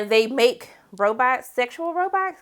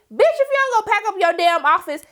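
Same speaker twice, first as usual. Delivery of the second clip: bossy snap; 8 semitones higher than usual. Retold or imitated. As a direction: fire her with sass. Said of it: "She was like".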